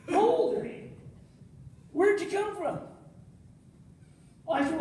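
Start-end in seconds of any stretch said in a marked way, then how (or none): 0.1-2.8 incredulous cop. Retold or imitated. Cop questioning him.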